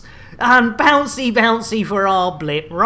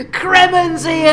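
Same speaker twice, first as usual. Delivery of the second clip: excited greeting; 7 semitones higher, 5 dB louder than usual. Real, not acted. Streamer greets arriving viewer.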